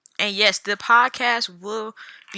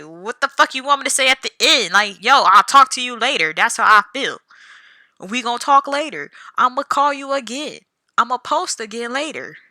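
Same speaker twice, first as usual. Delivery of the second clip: loud, higher exasperated rant; exasperated outburst; 4 semitones higher, 7 dB louder than usual. Retold or imitated. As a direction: throw it out loud in disbelief. Mocking the expected outro, exasperated.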